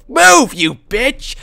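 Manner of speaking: loud, high, drawn-out yell; angry shout. Real, not acted. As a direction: yell at someone in the way. Snaps at character blocking way.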